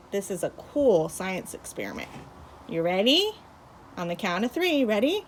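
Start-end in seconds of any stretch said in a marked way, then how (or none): none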